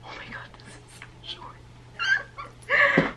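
slow, high, breathless shock; shocked whisper. Real, not acted. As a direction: gasp it out in disbelief. Shock at short haircut.

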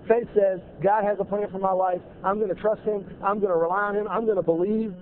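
ordinary talk

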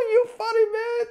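high-pitched laughing voice. Retold or imitated. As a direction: laugh while teasing. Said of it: "laughing like".

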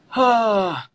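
big, high exhaled sigh; heavy sigh to compose yourself; loud emotional sigh. Real, not acted. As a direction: let it all out in a loud "hah". Composing self after emotional moment.

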